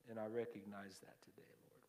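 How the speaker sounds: unvoiced, hushed whisper to God; whispered prayer. Real, not acted. Pastor turning aside to whisper a prayer to the Lord. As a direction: breathe the words as a private prayer.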